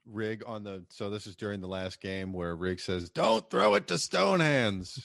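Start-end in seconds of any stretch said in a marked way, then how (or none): 3.1-5.0 high, loud, drawn-out mock warning; imitated sideline yell. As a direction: call it out slowly across the field. Acts a shouted warning during game.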